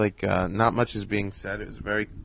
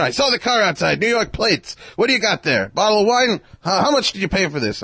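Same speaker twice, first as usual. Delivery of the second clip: imitating Mel Brooks; 10 semitones higher, 5 dB louder than usual. Mel quizzing the guest.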